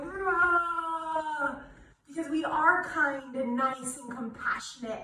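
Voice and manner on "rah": gorilla shout; gorilla rah. Shown as a demonstration. Teacher leads kids in a gorilla chest-pound shout. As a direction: bellow it like a gorilla beating its chest. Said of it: "pound on our yogi hearts like a gorilla and go".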